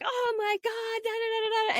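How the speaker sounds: high, exasperated imitation of a sports fan; husband mimicked ranting at the TV; mock upset rant. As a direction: whine it like a fan who just lost. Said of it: "going crazy because he's so upset".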